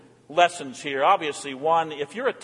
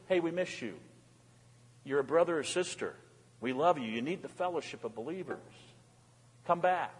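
tender appeal; 8 dB quieter than usual. Acted. Church member phoning a missing brother or sister, tender. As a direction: coax them back quietly. Voices caring call to absent member.

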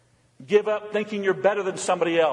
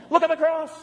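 fervent cry; 9 semitones higher than usual. Acted. Preacher's rising refrain.

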